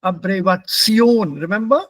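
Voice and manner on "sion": Sion held long and stressed. Shown as a demonstration. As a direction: lean firmly on Sion. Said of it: "This part is the one that is ... accented".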